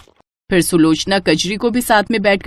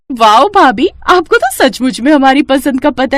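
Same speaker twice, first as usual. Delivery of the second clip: high, loud delight; excited wow; 5 semitones higher, 3 dB louder than usual. Acted. Sister-in-law delighted by the bride's cooking, excited. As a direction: gush over the food.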